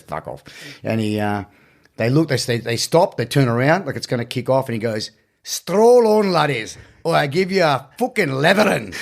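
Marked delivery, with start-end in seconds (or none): 0.0-0.4 blunt Scottish brush-off. Retold or imitated. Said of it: "pretty intimidating accent". 5.4-8.9 Scottish threat. Retold or imitated. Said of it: "I think it's a pretty intimidating accent".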